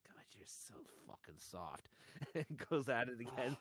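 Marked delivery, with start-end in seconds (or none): 0.1-1.9 slow, low whispered leer; creepy whisper. Retold or imitated. Describing the parody ad, the man's creepy whisper. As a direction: breathe it like a creep.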